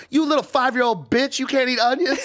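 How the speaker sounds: high, loud mock scolding; imagined insult. A guy imagines the cook mocking his onion complaint, loud mock scolding. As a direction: sneer it down at a child.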